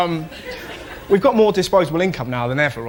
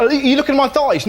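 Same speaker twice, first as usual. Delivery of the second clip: mock mum; 8 semitones higher, 3 dB louder than usual. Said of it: "so my mum's like".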